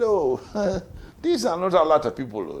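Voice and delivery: high, laughing through the words; laughing excuse. Retold or imitated. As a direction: chuckle the excuse away, caught out. Kwesi laughs off the crowd.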